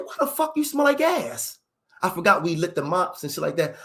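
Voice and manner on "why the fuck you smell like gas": high-pitched dad demanding answers; imitated angry interrogation. Imitating dad questioning son about missing school, high and irate. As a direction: grill the son, voice pushed up high.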